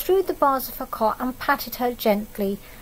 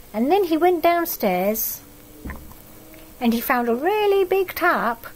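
usual speaking voice

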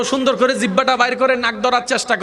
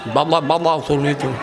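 toothless speaker; 5 semitones lower than usual. Shown as a demonstration. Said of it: "দাঁত নাই".

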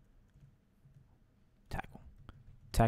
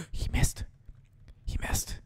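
gasped disbelief; 6 semitones higher, 9 dB quieter than usual. Real, not acted. Attack missed.

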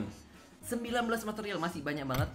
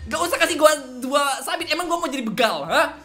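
mock indignant complaint; 8 semitones higher, 6 dB louder than usual. Complaining to the game's loot drops.

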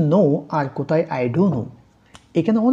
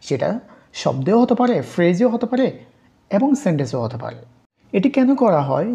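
usual speaking voice

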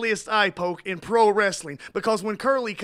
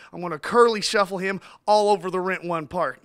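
normal talking voice

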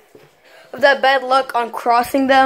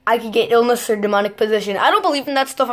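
usual speaking voice

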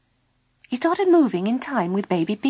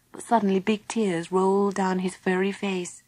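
ordinary delivery

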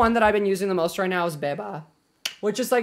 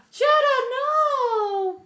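very high drawn-out wail to the dog; gasp, then cry out high and loud; dismayed cry; 5 dB louder, much slower than usual. Real, not acted. Owner begging the dog to stay, high wail.

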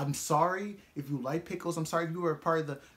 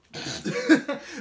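high, loud shocked laugh; burst of laughter; 8 dB louder than usual. Real, not acted. Reacting to her threat, a loud shocked laugh.

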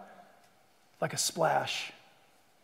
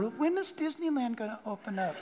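distracted thought; 2 semitones higher, 7 dB quieter than usual. Mock wandering mind during prayer.

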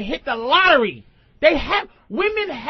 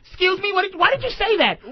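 raised, prissy voice of an offended woman chasing after him; indignant woman; 7 semitones higher than usual. Retold or imitated. Woman chases him down, offended and high-pitched. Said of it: "she'll chase after you and go".

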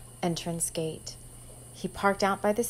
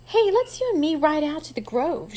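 higher, louder, flirty come-and-get-me invitation; 7 semitones higher, 5 dB louder than usual. Acted. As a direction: lure him out to the Grove. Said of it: "in her best come-and-get-me voice".

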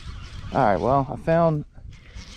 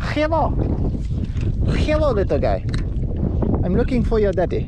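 put-on high, cooing baby-talk voice; baby talk to a rat; 6 semitones higher than usual. Angler coos at a rat swimming by his boat, high baby talk. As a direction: coo at the little rat like a toddler. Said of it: "I don't know why I'm talking like that".

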